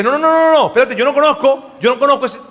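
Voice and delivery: hammed-up panic, feigning ignorance; panicked denial. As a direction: play dumb in a hurry, half laughing. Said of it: "se agarra y dice".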